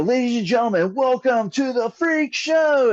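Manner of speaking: big radio intro voice, stretched out; radio announcer intro. Shown as a demonstration. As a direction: announce the show like a ringmaster. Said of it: "like, radio announcer. Watch him do some intro".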